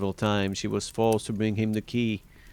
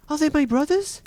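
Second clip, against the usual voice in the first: very high, loud urgent question; anxious hope; 8 dB louder than usual. A narrator's reading. Wife asking whether rescue is coming, anxious high cry. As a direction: ask with desperate, high-pitched urgency.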